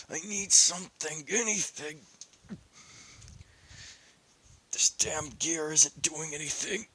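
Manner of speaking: strained, pleading inner thoughts; desperate inner voice. Acted. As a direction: beg for strength while barely able to breathe. Issei's struggling inner monologue.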